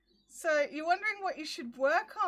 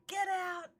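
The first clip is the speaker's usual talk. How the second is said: higher, angry get out, half-played; snapping get out at the dog, retold; retold yell; 4 semitones higher than usual. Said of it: "I'm yelling at him".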